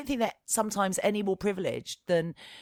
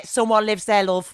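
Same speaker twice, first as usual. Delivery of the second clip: louder, hammed-up Scouse; bad Scouse accent; 8 dB louder than usual. Mum imitating a local who stops her daughter, bad Scouse accent. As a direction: attempt a Scouse accent, badly. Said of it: "that's a terrible Scouse accent".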